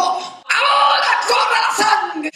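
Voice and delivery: high, slow, drawn-out battle cry; chief's shout. Retold or imitated. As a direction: call the attack as the chief. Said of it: "el jefe de los mexicas gritó".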